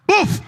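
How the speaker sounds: loud high imitated boof of a kicked door. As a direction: shout the crash of the door.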